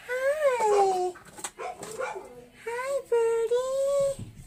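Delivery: high, soft cooing. Real, not acted. Coaxing trapped bird.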